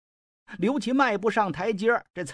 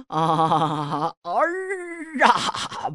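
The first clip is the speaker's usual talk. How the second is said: crying out; much slower than usual. Acted. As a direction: cry out to the absent son with the last breath. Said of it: "大叫了几声". (临终高声呼喊儿啊)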